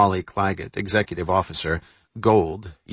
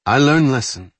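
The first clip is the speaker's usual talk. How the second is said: put-on accented English; 6 semitones higher, 6 dB louder than usual. Carol promises, raised voice.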